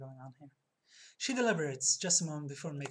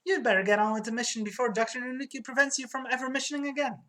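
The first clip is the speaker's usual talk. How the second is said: higher-pitched acted scolding; urgent warning; 6 semitones higher than usual. Clerk warning agent about Doctor Nunuki, urgent scolding. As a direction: push it out high and urgent.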